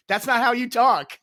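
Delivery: loud, high-pitched imitated correction; mock scolding. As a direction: call out the mistake like an exasperated coach. Imitating his coach's scolding correction.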